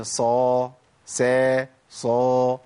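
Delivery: صا heavy, سا light, alternating; contrasting S sounds. Shown as a demonstration. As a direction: switch between heavy صا and light سا. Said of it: "غير الصاد أقول إيه؟".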